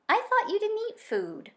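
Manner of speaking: high, suspicious scowl; a sly fox getting cross. Acted. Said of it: "the fox scowled".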